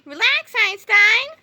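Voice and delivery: plain reading voice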